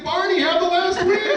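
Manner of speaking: high, drawn-out mock urging; buddies' hearty urging. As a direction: egg him on like loud pals. Buddies urge him to eat up.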